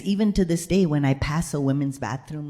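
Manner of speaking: ordinary talk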